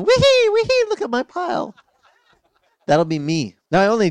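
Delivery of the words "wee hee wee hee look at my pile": very high, loud squeaky glee; gleeful little stick man. Retold or imitated. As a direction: squeal like a tiny man atop his treasure. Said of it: "the little stick man at the top going".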